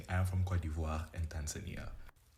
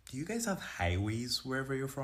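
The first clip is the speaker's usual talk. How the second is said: higher-pitched, innocent-sounding query; mock naive question; 6 semitones higher than usual. Acquaintance asking an African about highways, high mock naive question. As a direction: ask it high and clueless. Imitating person's ignorant question.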